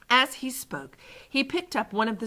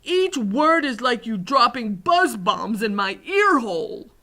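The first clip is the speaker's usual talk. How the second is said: loud, high giant's begging; distressed plea; 5 semitones higher, 7 dB louder than usual. A narrator's reading. Poor giant begs for his ears, crying out. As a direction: plead at full voice, emphatically. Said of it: "cried the poor B F G".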